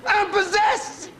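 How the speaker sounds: very high, slow mock shriek; Freddie's possessed scream. Freddie making his move on Gloria, screaming. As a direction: scream it in a piercingly high put-on voice. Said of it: "He started screaming".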